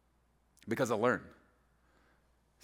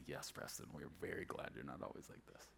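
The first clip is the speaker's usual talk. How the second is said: very low, barely voiced deadpan; dry, hushed reply; 13 semitones lower, 23 dB quieter than usual. Preacher imitating college professors answering him, dry and hushed. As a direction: drop right down, imitating a weary aside.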